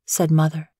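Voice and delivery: usual speaking voice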